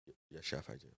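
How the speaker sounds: low, hushed mock-timid answer; meek, terrified reply. Cook answering towering imagined chef, timid and hushed. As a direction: stammer a very soft, obedient reply. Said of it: "half terrified".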